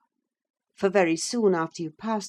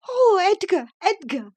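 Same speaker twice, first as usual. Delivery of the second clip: high, loud, breathless cry of a name; breathless young wife bursting in on husband; panting with excitement; 7 dB louder than usual. Acted. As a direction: gasp his name out in wild excitement. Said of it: "she panted".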